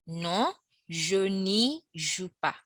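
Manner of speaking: very slow, deliberate repeat; slow teacher repeat. Shown as a demonstration. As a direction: stretch it out for learners. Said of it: "you'd have".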